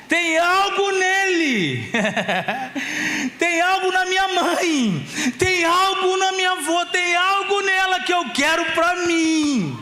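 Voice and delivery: put-on high, slow voice of an admiring child, laughing; child in awe. Son imagining his mother and grandmother, high and awed. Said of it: "O nosso filho vai olhar pra gente e falar assim".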